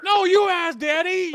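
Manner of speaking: put-on high, loud whining kid, laughing; four-year-old whine. Mock-whining at his friend like a four-year-old child. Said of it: "I'm being a four-year-old".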